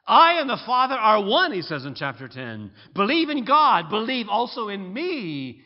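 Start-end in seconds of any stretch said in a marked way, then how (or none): 0.1-1.5 loud, high declaration; proclaimed. Real, not acted. Proclaims Jesus' claim. 2.9-5.6 loud, high call; ringing proclamation; proclaimed. Real, not acted. Proclaims Jesus' words.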